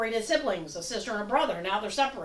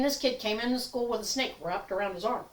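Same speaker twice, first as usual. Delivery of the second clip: normal talking voice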